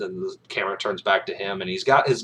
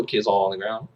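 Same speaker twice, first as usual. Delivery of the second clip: normal talking voice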